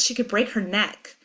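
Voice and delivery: plain reading voice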